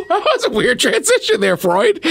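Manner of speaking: laughing teasing remark. Real, not acted. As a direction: tease while still laughing.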